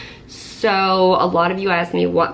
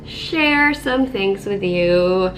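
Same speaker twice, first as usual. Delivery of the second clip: very slow, sickly voice; much slower than usual. Real, not acted. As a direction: drag the words out, under the weather. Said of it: "my very slowed down sick voice".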